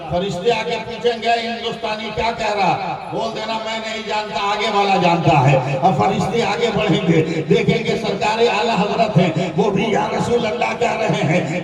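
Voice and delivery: loud, driving sermon storytelling; full-force preaching. Acted. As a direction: preach the dialogue out to a big crowd. Preacher acts out angels questioning saints.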